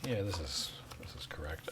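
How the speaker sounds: soft unvoiced whisper. Real, not acted. Hushed confirmation.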